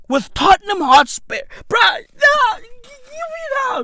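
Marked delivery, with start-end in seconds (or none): 1.7-3.8 hammed-up sobbing protest; mock crying. Vlogger upset at Tottenham Hotspur sponsorship, high mock-crying wail. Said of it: "I'm out here crying".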